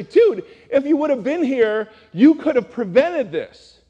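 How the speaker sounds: hammed-up exasperated sisters scolding a late friend; reproachful sisters. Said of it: "Both sisters come out and say".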